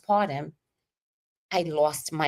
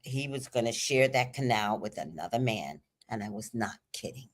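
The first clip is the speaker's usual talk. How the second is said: ordinary talk, nothing marked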